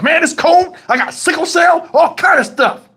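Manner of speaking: high-pitched, agitated retold complaint; pissed off. Driver lying to the officer about the cold, pissed off. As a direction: act out piling up indignant excuses. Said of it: "I was just pissed".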